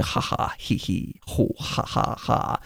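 breathy, hushed fake laugh; mock Joker laugh. Podcaster mimicking the Joker's entrance laugh, hushed fake cackle. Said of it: "'cause he's going".

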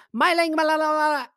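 high, loud rapid gibberish; mock Chinese shouting. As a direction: call out to a coworker in fake Chinese. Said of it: "she goes".

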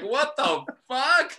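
drawn-out high shocked cry; slow, stunned disbelief. Retold or imitated. Waking up near noon, panicking.

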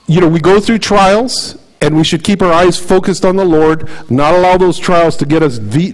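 ordinary talk, nothing marked

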